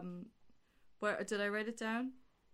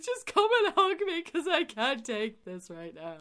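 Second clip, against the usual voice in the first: higher and louder, slow, whimpering plea; mock tearful plea; 7 semitones higher, 7 dB louder than usual. Retelling begging James for a hug, hammed-up tearful. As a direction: beg for a hug through mock tears. Pleading with James during film.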